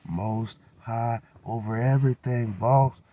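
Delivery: slow, then punch boss loud and high; a bold, slow proclamation ending in a shout; proud declaration. Retold or imitated. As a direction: say it proudly and slowly, landing hard on boss.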